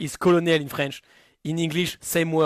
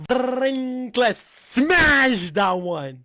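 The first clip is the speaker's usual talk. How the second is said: drawn-out bold claim; 7 semitones higher, 5 dB louder, much slower than usual. Real, not acted. Mock bold answer.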